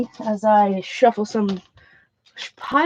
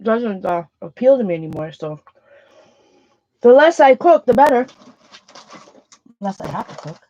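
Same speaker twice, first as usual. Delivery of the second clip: chewing while talking; mouth full. Real, not acted. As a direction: talk through a mouthful. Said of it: "excuse me with chewing with my mouth full".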